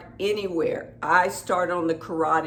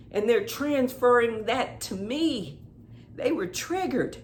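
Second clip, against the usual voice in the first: angry venting; 5 semitones higher than usual. Acted. Tapping on the psychic attack, venting angrily to the viewer. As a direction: rant at whoever attacked you. Vents anger while tapping.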